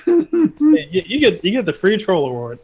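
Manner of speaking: normal talking voice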